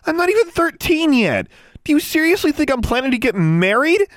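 very high, loud tirade; shrieked indignant questions. A narrator's reading. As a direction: rant at him in disbelief. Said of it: "lower your voice".

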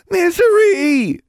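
very high, drawn-out joyful shout; exclaiming. A narrator's reading. Young witch spotting her lost toad, exclaiming. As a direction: cry out the pet's name, loud and long. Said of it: "Innocence exclaimed".

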